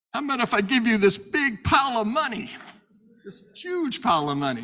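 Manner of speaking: high, loud, excited sales pitch; Balak's tempting offer. Acted. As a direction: dangle a huge reward, punching the key words. Acts Balak tempting with money.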